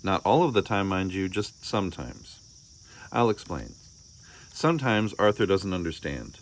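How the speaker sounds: ordinary delivery, nothing marked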